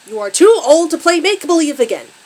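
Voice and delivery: loud, high angry father's scream; furious scream. A narrator's reading. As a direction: scream the scolding in one enraged run. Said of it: "Her father screams".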